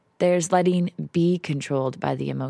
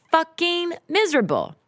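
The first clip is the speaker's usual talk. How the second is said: frustrated outburst; 11 semitones higher than usual. Real, not acted. Venting frustration alone.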